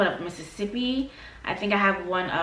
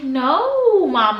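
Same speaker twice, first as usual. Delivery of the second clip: high, loud whine; pleading protest; 7 semitones higher, 8 dB louder than usual. Retold or imitated. Daughter protesting mom's no-dog rule, loud high whine. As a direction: protest like a begging kid.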